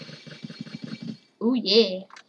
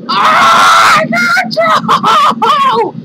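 shocked scream; 13 semitones higher, 9 dB louder than usual. Real, not acted. Falling in game.

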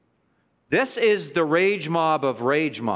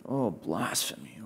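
low, hushed mock horror; soft, put-on dismay; fake outrage; 8 semitones lower, 9 dB quieter than usual. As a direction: murmur it as if scandalized, very softly. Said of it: "The fake outrage".

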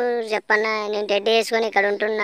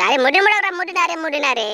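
higher, raised mock yell at the kids; mock scolding; 7 semitones higher than usual. Man in teddy suit shooing noisy kids, mock scolding. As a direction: shoo the children off, half-joking.

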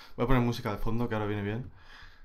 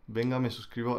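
usual speaking voice

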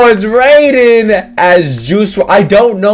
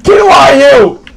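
high shouted calls; calling for help; 11 semitones higher, 3 dB louder, slower than usual. Real, not acted. Gamer shouting to a teammate for help. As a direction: call out to a teammate.

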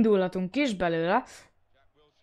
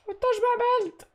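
pleading shout; 3 dB louder, slower than usual. Real, not acted. Urging the referee.

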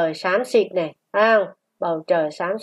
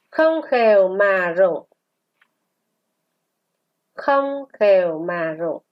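model reading. Shown as a demonstration. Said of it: "Luyện đọc qua từ". (đọc mẫu chậm, ngân dài)

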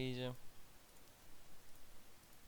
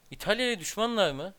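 louder and higher, baffled; shocked outburst; 6 semitones higher, 7 dB louder than usual. Real, not acted. Gamer baffled by enemy alliance, loud disbelief. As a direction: blurt it out in disbelief.

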